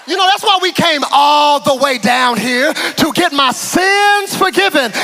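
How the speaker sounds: loud, high mock gratitude; sarcastic thanks. Paralysed man sarcastically thanks Jesus, loud and high. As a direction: drip sarcasm, loud and laughing.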